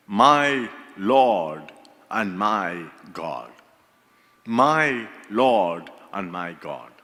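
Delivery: low, slow, hushed devotion; reverent confession. Acted. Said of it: "confessed that profession of faith".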